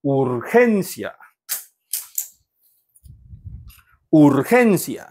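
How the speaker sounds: 우르헨시아 with the stress on 헨; stress on second-last syllable. Shown as a demonstration. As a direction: lean hard on 헨 in 우르헨시아. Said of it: "뒤에서 두 번째 음절에 강세가 있어서".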